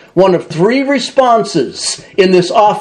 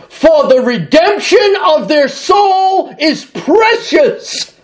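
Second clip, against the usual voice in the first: high, slow, ringing proclamation; preached emphasis; 7 semitones higher, slower than usual. Acted. Preacher proclaiming scripture to the church, slow and ringing. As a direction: proclaim the verse with full force.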